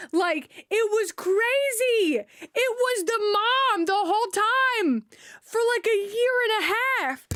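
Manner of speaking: high, slow, strained disbelief; stunned disbelief. Real, not acted. Reacting to the mom's year of texts, high stunned disbelief. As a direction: let the shock stretch every word out.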